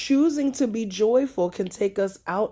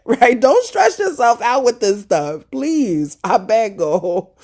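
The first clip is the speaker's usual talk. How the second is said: louder, earnest plea; pleading, louder; 5 semitones higher, 9 dB louder than usual. Real, not acted. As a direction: beg them to stop stressing. Pleading with congregation.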